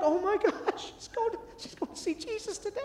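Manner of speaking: very high, softened horrified voice; retold dad's panic, voice gone high and small; mock panic. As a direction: squeak it out in comic dread. Dad panics after reading warning.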